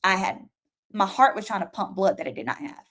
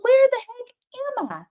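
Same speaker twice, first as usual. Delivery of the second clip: much higher, bewildered outburst; astonished. Retold or imitated. Waking up in a heavenly field, shocked and much higher. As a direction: blurt it out in total disbelief.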